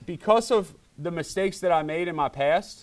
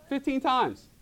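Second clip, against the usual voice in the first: laughing at himself; 8 semitones higher, 3 dB quieter than usual. Real, not acted. Preacher laughing at his own Mexico story. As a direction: chuckle through it. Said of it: "laughs while saying".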